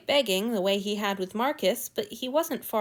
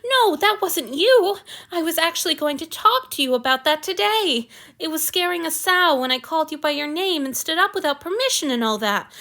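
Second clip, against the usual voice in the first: distressed apology; 8 semitones higher, 5 dB louder than usual. A narrator's reading.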